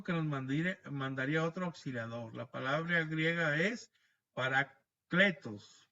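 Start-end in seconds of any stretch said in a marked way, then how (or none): 4.3-5.9 paracletos drawn out very slowly, syllable by syllable, said louder; Greek word spelled out. Shown as a demonstration. Preacher teaching the congregation a Greek word, slow and deliberate. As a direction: pronounce paracletos slowly and carefully, every syllable clear. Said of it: "así, con acento en la E".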